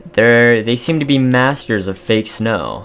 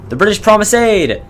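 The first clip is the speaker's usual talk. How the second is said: excited announcement; 11 semitones higher, 6 dB louder than usual. Acted. Reading good news event.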